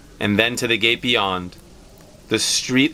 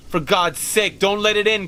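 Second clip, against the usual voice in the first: higher, pleading, a touch of terror; trembling cry; 9 semitones higher than usual. Acted. Husband terrified of what knocks, begging his wife, mildly fearful. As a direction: beg him to keep it out, mildly shaken. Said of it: "cried the old man, trembling".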